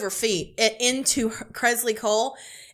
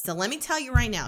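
ordinary talk

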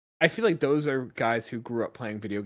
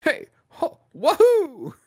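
high squeaky Italian plumber cheer; Mario voice; 11 dB louder, much slower than usual. Retold or imitated. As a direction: shout it like Mario jumping. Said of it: "Chris Pratt trying to do an Italian accent".

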